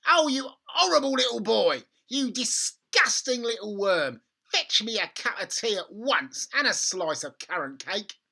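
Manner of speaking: put-on high, loud insulting old woman; grandma scolding George for tea; furious grandma. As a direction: spit the insults.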